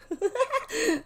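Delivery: real high-pitched giggle; giggling laugh. Real, not acted. Viewer cracking up at a reaction clip, high laugh. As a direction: laugh your head off, high. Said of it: "I was giggling my head off".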